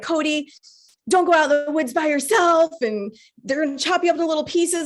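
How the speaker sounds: mock a mom cautioning her daughter; imitated worried mom. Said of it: "my mom has always told me".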